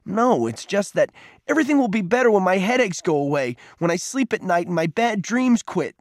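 high, hurt and angry; bitter, wounded boy; upset outburst. A narrator's reading. Peter angrily lists his injuries.

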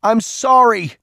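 high, loud cry of remorse; screamed apology. A narrator's reading. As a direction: call it out into the darkness. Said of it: "he screamed louder".

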